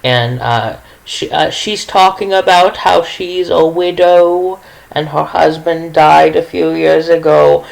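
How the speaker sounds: ordinary talk, nothing marked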